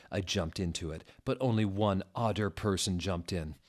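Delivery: usual speaking voice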